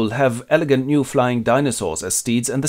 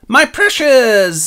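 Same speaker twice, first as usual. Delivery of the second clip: high, loud, drawn-out creature cry; mock Gollum shriek; 12 semitones higher, 7 dB louder, much slower than usual. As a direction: stretch the cry out slowly like a crazed creature. Gollum attacks.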